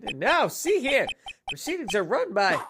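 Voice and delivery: put-on stern prosecutor with a German accent; haughty German-accented prosecutor. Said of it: "a German accent instead of Russian now".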